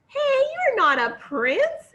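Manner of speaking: high loud startled exclaim; shocked outburst at the pig. Acted. Princess sees a pig instead of prince, high loud exclaim. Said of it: "exclaimed the princess".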